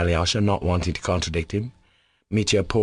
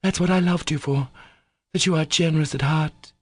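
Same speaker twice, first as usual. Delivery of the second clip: higher, bursting out; broke out; 9 semitones higher than usual. Acted. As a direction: let it break from her. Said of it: "broke from Katya".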